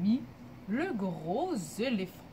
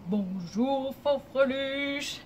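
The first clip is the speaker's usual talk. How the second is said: drawn-out, lightly deepened big voice; big deep voice; much slower than usual. Shown as a demonstration. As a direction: boom the greeting slowly in a low voice. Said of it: "avec ta grosse voix grave". (grosse voix grave, lente)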